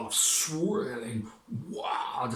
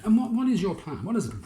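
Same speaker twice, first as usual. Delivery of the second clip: high, loud eager mayor; fussy mayor; 10 semitones higher, 8 dB louder than usual. Acted. Mayor pressing the fisherman for his plan, high and loud. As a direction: demand the plan, fussing.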